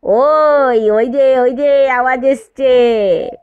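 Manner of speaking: thrilled shout, last words drawn out slow; excited cry. A narrator's reading. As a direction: uncle bursting out at the clang. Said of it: "প্রায় লাফিয়ে উঠল".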